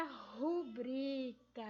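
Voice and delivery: slow rubrica, stress on BRI; correct pronunciation. Shown as a demonstration. Teacher introducing the word, drawn-out rubrica stressed on BRI. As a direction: stretch the middle syllable of rubrica. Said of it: "a pronúncia correta dela é rubrica".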